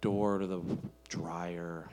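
low, slow, dragged-out complaint; hammed-up reluctant whine to a spouse, slow and low; weary reluctance. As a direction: drag each step out wearily, sinking low. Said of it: "We add steps to the process".

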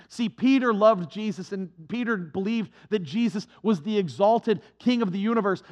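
usual speaking voice

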